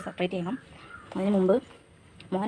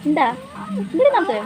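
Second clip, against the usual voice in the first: high, loud sing-song calls; 13 semitones higher, 7 dB louder than usual. Real, not acted. Mother calling her baby to look at the camera, high coaxing voice.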